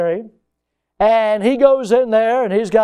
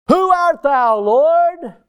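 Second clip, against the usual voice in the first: high, louder, ringing out; Paul calling to the voice from heaven, high and louder; awed question; 10 semitones higher, 5 dB louder, much slower than usual. Retold or imitated. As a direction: cry it up toward heaven.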